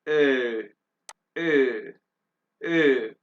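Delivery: ए said as German Ö, three times; teacher repeating the Ö sound; umlaut Ö. Shown as a demonstration. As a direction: model the Ö vowel. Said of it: "और इसको क्या कहते हैं".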